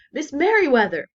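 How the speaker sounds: loud calling out of a name; called out. A narrator's reading. Librarian calling his boss loudly. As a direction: call across the library in alarm. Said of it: "he called".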